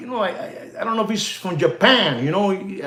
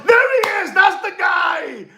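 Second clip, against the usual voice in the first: excited recognition; 10 semitones higher than usual. Real, not acted. Spotting the guy he was trying to name, shouting excitedly. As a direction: yell it out in real triumph. Finally recognises the man searched for.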